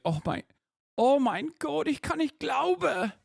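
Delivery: squeaky, wailing German accent impression; mock German accent. Shown as a demonstration. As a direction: exclaim in a hammed-up German accent. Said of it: "this was more like an Austrian accent".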